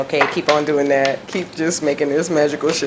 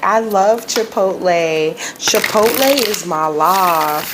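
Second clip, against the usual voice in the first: light imitation of a heavy Southern accent, slow; Southern accent meme; 4 semitones higher, slower than usual. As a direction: drawl it slowly like the Southern kid meme, lightly done. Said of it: "that heavy Southern accent".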